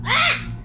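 sudden high yelp; short yelp. Real, not acted. Sudden yelp during play.

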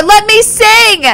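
pleading cry. Real, not acted. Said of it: "I just wanted to sing more".

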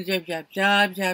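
ordinary delivery

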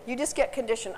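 ordinary talk

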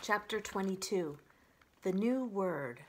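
usual speaking voice

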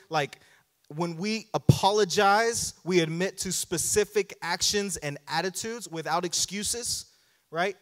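ordinary talk

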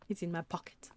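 breathless laughing half-whisper, quick. Real, not acted. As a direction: giggle the words out, fast. Laughing at cow in inventory.

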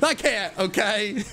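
high, squeaky plea; hammed-up high-pitched defence; goofy protest. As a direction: squeal it as a helpless excuse. Relapses into goofy streamer tone.

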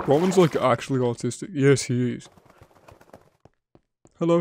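usual speaking voice

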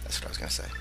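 breathy whispered joke; soft whispered aside. Real, not acted.

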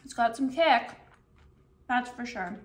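talking with food in mouth; muffled through a mouthful; chewing while talking. Real, not acted. Seen 2: Burger in hand after bite, lips pressed, cheeks working while talking.

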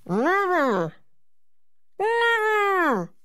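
high loud cry of NEVER; Nutcracker shout. A narrator's reading. Nutcracker leaping free, shouting defiance. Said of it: "shouted the Nutcracker again".

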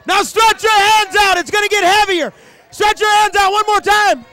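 high, loud commanding call; fervent pulpit command; preaching at full force. Real, not acted. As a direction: drive the crowd to reach out.

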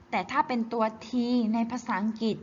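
usual speaking voice

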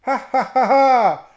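loud, high villain cackle; booming mocking ha-ha-ha; devilish laugh. A narrator's reading. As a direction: cackle loudly like the devil, voice leaping up. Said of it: "lachte der Teufel".

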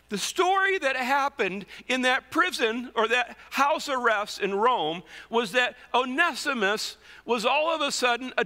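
climbing sermon fervour; preaching at full force. Acted. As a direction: preach it to the back row.

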